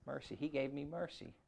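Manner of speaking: ordinary delivery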